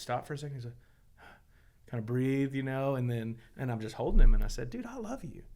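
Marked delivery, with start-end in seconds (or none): 4.7-5.4 higher and softer, gently reassuring; soft, higher comfort to a son; tender reassurance. Retold or imitated. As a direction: soothe a hurting kid who feels rejected. Father comforting his sobbing son.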